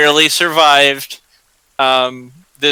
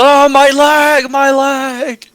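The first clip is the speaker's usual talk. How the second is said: high, drawn-out pained wail; screaming in pain; 12 semitones higher, 4 dB louder, slower than usual. Acted. Game master voicing an injured man, acted pained scream. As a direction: cry out loudly over a hurt leg.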